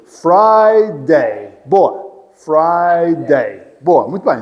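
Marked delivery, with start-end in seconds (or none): 0.1-1.6 Friday drawn out as FRI... DAY; two-syllable Friday. Shown as a demonstration. Teacher showing Charles two syllables, drawn-out Friday demo. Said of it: "Duas sílabas". 2.3-3.7 Friday stretched into FRI... DAY; two-syllable Friday. Shown as a demonstration. Teacher repeating for Charles, syllable-by-syllable Friday demo. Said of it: "Duas sílabas".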